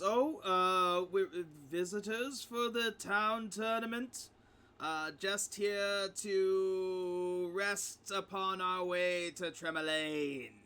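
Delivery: put-on slow, drawn-out old crone voice. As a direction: answer as a crone, stretching the words. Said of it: "transforms into her old crone form".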